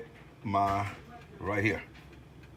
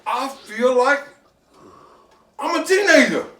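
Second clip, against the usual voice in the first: giddy excitement; 12 semitones higher, slower than usual. Real, not acted. Tasting stew, nostalgic and giddy.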